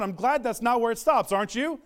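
normal talking voice

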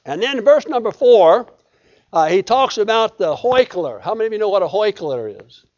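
ordinary talk

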